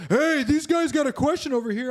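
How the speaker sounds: high, loud call across the room. Retold or imitated. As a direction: call the others over. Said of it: "he walks over".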